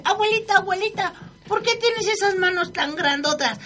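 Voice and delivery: put-on high girlish pleading question; little girl asking. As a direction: ask like a small girl. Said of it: "le dice".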